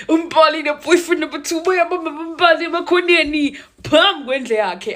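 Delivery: high, loud laughing report; excited gossip. Retold or imitated. Reporting the scandal she saw outside Mbali's house, laughing. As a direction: giggle it out like scandal.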